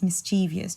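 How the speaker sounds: mischievous with four syllables, mis-CHEE-vee-us; common mispronunciation. Shown as a demonstration. Teacher quotes many people's error, mischievous as mis-CHEE-vee-us. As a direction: put an extra ee in mischievous, stressing CHEE. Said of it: "many people say it's mischievous".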